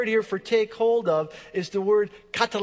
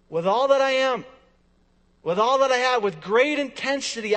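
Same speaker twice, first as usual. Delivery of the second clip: loud, raised-pitch declaration; fervent intensity; 4 semitones higher, 5 dB louder than usual. Acted. Preacher voicing Paul pursuing God, fervent intensity. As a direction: punch out total commitment.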